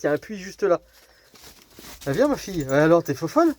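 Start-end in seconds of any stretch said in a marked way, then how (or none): none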